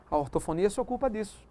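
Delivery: ordinary talk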